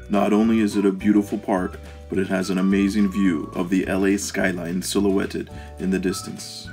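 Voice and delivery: ordinary talk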